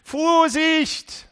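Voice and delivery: high, loud cry of warning; Jesus shouting a warning; alarm shout. Acted. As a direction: yell it like danger is right there.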